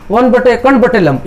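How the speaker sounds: brisk, run-together reading of the fraction; quick demonstration reading; read quickly. Shown as a demonstration. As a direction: rattle it off as one quick phrase. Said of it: "इसको तेज पढ़ेंगे".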